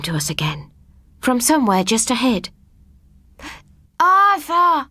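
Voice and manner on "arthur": high, distant, pitiful wail of a name; pathetic wail. A narrator's reading. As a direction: cry out helplessly from far away. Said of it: "it wailed pathetically".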